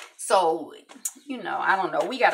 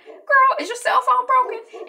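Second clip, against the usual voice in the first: much higher and louder, incredulous; sassy mockery; 9 dB louder than usual. Journalist mocks Sydney's paper invite, high and sassy. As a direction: throw it at her like she's crazy.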